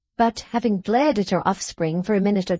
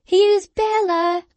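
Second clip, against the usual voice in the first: high, drawn-out bawl; 12 semitones higher, 4 dB louder, much slower than usual. A narrator's reading. Lavvy calls her mother to the door, bawling. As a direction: bawl it into the house, slowly. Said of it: "and then bawled".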